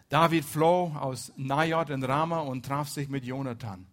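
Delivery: ordinary talk, nothing marked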